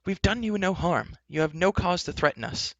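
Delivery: high, protesting hero; crying out. Acted. Taryn protesting to the enchantress, crying out. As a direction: plead the case in a high voice. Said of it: "Taryn cried".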